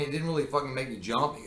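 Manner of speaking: ordinary talk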